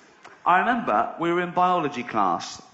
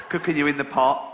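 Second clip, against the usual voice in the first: usual speaking voice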